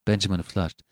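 ordinary delivery, nothing marked

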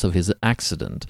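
usual speaking voice

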